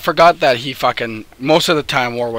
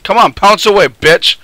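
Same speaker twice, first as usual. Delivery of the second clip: loud taunt; 6 semitones higher, 7 dB louder than usual. Real, not acted. Taunting enemy loudly.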